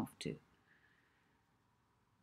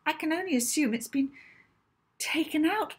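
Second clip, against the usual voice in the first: high, louder, flustered and apologetic; nervous piping voice; 10 semitones higher, 6 dB louder than usual. A narrator's reading. Librarian apologising to the angry professor, nervous high piping. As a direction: pipe up like a frightened little wren. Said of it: "The librarian's wren-like voice piped up nervously".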